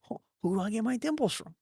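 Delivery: high, fast baffled question; bewildered. Retold or imitated. Comedian reenacts asking himself about his dimples, baffled and high. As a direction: puzzle over it in disbelief.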